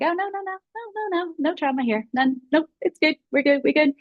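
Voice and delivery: high, breezy brush-off of any trauma; sing-song no no no, all is fine; mock denial. Imitating her past self brushing it off to a questioner, high and breezy. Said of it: "I probably would have been like".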